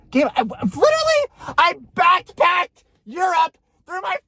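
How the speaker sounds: very high, strained rant; hammed-up high-pitched tirade; exasperated outburst. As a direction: plead your case in a high yell. Acts out her outburst to ex.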